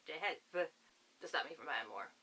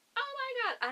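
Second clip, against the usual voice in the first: much higher and louder oh my god; delighted gasp; 5 dB louder than usual. Real, not acted. Showing viewers the cover under the jacket, delighted squeal.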